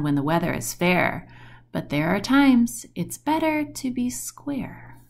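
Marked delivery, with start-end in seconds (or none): 2.9-5.0 secret whisper to the listener; a storyteller's hushed aside. A narrator's reading. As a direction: lean in and whisper it. Narrator whispers the story's moral.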